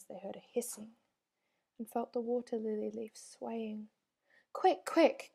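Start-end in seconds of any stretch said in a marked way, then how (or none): 4.5-5.3 urgent cry. A narrator's reading. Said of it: "cried Moomin Mama".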